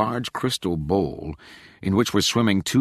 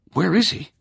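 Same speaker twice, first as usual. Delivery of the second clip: calling out; 12 semitones higher, 3 dB louder than usual. A narrator's reading. Rachel calls into dark room.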